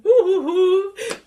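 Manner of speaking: bright burst of laughter. Real, not acted. Host laughs mid-sentence.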